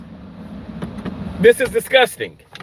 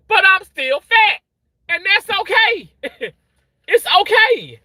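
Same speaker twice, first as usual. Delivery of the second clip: high, overwrought voice with broken laughs; very emotional; 6 semitones higher than usual. Real, not acted. Reassuring oneself about weight through emotion, very emotional. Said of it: "obviously I'm very emotional".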